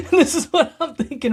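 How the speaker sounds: high, laughing through the words; chuckling at himself; laughing aside. Real, not acted. Speaker laughing at his old self to audience.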